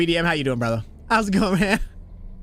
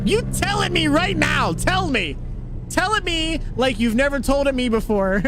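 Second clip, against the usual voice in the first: teasing dare; 8 semitones higher than usual. Pretend. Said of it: "daring a viewer to yell".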